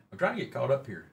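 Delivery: ordinary talk, nothing marked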